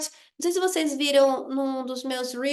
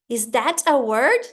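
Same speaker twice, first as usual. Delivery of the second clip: slow, louder teacherly question; mock-puzzled quiz; 2 semitones lower, 5 dB louder, slower than usual. Shown as a demonstration. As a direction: ask small children, drawing it out. Teacher models quizzing her pupils.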